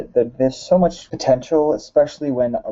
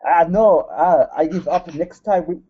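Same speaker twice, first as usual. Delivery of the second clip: mock Russian accent on request, pitched higher; fake Russian accent; 7 semitones higher than usual. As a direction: redo the Russian wrestler voice when a friend asks. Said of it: "talk about it in your fake Russian accent".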